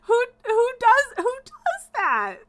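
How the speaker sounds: can't believe anyone would do this; incredulous outrage. Real, not acted. Ranting about teammates quitting.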